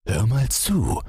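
persuasive. A narrator's reading. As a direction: coax the child into listening. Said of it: "sagte der Vater überredend".

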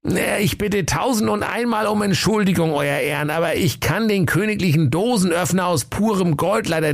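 hoher, ängstlicher Diener; zitternde Stimme. Acted. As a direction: stockend und bang entschuldigen. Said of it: "sprach mit zitternder Stimme". (ängstlicher Diener, zitternde Stimme)

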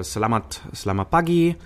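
"selamat pagi" said as a lifted, bright Indonesian greeting; "selamat pagi" greeting with the pitch up on "pagi"; lilting Indonesian "selamat pagi". Shown as a demonstration. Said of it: "wie klingt Indonesisch?".

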